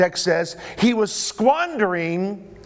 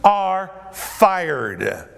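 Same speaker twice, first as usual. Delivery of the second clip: very slow, word-by-word pronouncement; master firing steward; much slower than usual. Acted. Master sacking his steward in modern words, slow pronouncement. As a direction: draw out each word of the sacking. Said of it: "in modern day vernacular".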